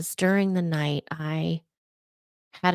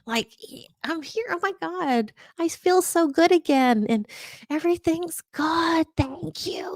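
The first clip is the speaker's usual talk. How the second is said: dead dog's ghostly voice; 10 semitones higher than usual. Retold or imitated. Ghost dog reassuring her owner in a high whisper. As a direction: whisper it up high like a happy spirit. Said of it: "She's like".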